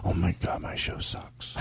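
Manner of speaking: hushed, nearly voiceless dismay; mortified aside. Real, not acted. Host despairing to himself that his show sucks, mortified whisper. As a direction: let it sink to a whisper, defeated.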